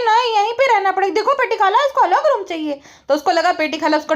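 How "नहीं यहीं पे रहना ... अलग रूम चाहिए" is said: higher-pitched mimicked objection; mock complaint. Vlogger acting out relatives refusing a separate room, mock complaint. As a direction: quote them in a raised, complaining voice.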